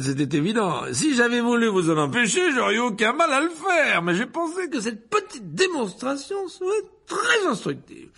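high, loud, self-satisfied lecturer voice; pompous wizard boasting to the hall; vain showman professor. A narrator's reading. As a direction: preen before the students. Lockhart boasting to Snape.

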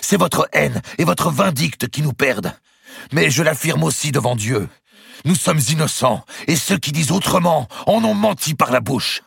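high, forceful public denunciation; indignant protest. A narrator's reading. Second Templar accuses archbishop and swears innocence, indignant protest. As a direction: declaim the accusation passionately.

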